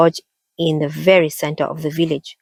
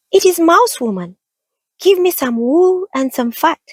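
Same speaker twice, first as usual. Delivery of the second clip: tiny mouse squeak, much higher; high squeaky voice; 12 semitones higher than usual. A narrator's reading. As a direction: squeak like a little mouse woman. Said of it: "a high, squeaky voice".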